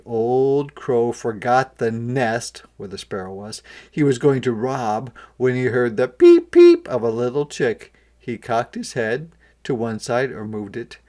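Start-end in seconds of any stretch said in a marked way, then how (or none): none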